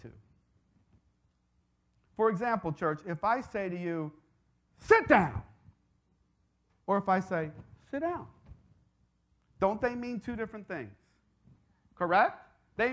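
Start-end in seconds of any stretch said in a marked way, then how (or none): 4.8-5.4 loud, sharp order to sit; stern, forceful command. Shown as a demonstration. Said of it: "because of the tone of my voice". 7.9-8.3 soft, easy request; quiet, inviting tone; gentle invitation. Shown as a demonstration. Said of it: "because of the tone of my voice".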